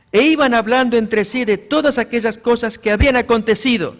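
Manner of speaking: loud, solemn Bible reading; scripture reading. Acted. Preacher reads Luke to the church, loud and solemn. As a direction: proclaim the text to the congregation.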